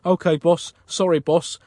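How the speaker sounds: ordinary delivery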